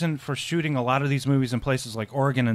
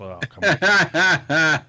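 exasperated; 3 semitones higher, 5 dB louder, much slower than usual. Real, not acted. Mock exasperation at the joke.

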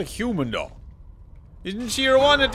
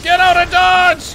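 loud, high panicked yelling; panicked shouting; 8 semitones higher, 6 dB louder, much slower than usual. Real, not acted. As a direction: scream the order to flee in real panic. Panicked retreat from Reavers.

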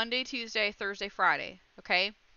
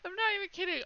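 high, overwhelmed near-tears voice; sigh, then squeak it out tearfully; happy tears threatening; about to cry; 9 semitones higher, faster than usual. Real, not acted. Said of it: "I'm seriously gonna cry".

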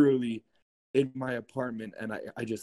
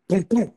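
loud, high-pitched double boom; imitated demon clap; 9 semitones higher, 6 dB louder than usual. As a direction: voice the demon's clap as two big booms. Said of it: "that demon does a clap".

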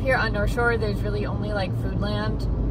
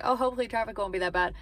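quick, lightly mocking her own earlier hope; a hint of self-parody, quick and breezy; naive hopeful quote; 3 dB quieter, much faster than usual. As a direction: rattle it off as a mild mock of her past optimism. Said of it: "remember when I was like".

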